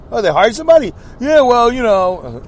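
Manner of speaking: high, loud breezy mock exchange; mock casual reply. Retelling the friend's excuse about hiring, high and breezy.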